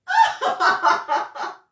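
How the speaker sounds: high, loud incredulous laugh; a loud helpless laugh; real high laugh; burst of high laughter. Real, not acted. Laughs at the repeated question.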